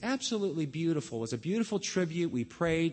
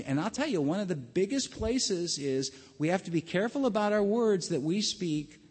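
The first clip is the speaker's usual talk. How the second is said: normal talking voice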